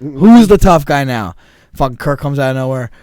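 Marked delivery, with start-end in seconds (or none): 0.0-1.4 slow, louder, higher taunting shout. Retold or imitated. Repeating the dare at the car: loud slow taunt. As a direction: yell it in their faces. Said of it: "I'm like".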